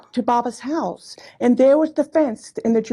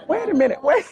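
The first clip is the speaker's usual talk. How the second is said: laughing; 7 semitones higher, slower than usual. Real, not acted. Laughing at her own remark.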